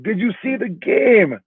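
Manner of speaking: high, slow, stretched-out question; imitating a thrilled Lithuanian guy; excited Lithuanian guy. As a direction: ask in high-pitched, drawn-out amazement. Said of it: "a Lithuanian guy was like".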